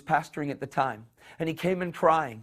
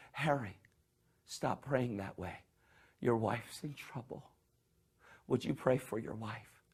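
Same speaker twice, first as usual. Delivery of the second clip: gentle voice of God; 2 semitones lower, 11 dB quieter than usual. Retold or imitated. God tells Harry to pray for wife, soft and gentle. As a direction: speak softly like a patient father.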